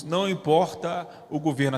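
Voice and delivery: usual speaking voice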